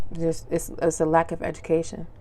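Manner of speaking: ordinary delivery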